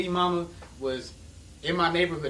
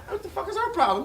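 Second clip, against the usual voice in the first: laughing disbelief; 14 semitones higher than usual. Retold or imitated. Retold confusion at Jessica snubbing him.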